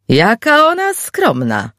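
high, slow call to the room. Acted. Gary calling out high and slow to the room. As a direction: sing it out high for everyone to hear. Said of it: "zawołał w niewiadomym kierunku".

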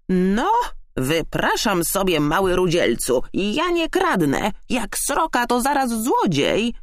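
high, offended magpie voice; indignant screech. A narrator's reading. Magpie denying she steals, indignant high voice. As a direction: protest hotly, climbing in pitch. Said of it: "zaskrzeczała oburzona".

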